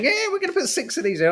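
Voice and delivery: high, fast, chirpy mock do-gooder. Mocking the pensioners on the planter, high chirpy do-gooder. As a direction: parody the keen pensioners in a piping voice. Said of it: "when they're all sitting on the planter going".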